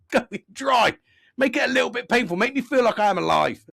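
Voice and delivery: high, loud, hammed-up mock thanks; sarcastic tirade. Customer inviting mechanic to abuse him, sarcastic and outraged. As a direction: escalate into absurd, exasperated mock pleading.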